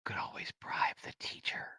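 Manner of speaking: 비밀스러운 속삭임; whisper. Pretend. Conspiratorial aside about bribing.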